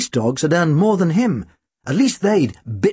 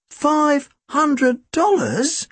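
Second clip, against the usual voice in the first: much higher, slow, rising disbelief; 12 semitones higher, much slower than usual. Acted. Tim repeats the sum, stunned and much higher. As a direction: let the sum hang in wonder.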